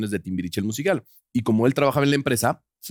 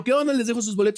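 much higher, overly cheerful hello; chirpy greeting; 10 semitones higher, faster than usual. Retold or imitated. Producer greeting the rival with fake cheer. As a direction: play it sugary sweet and innocent. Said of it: "Y salí yo".

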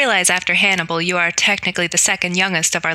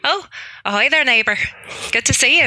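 higher-pitched, put-on Irish greeting; Irish accent; 5 semitones higher than usual. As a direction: greet the neighbor in your best Irish accent. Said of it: "do my best Irish accent".

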